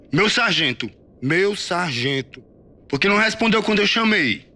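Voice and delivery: high, loud sergeant's rebuke; angry correction. A narrator's reading. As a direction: hammer the proper title at him. Sergeant corrects and berates recruit.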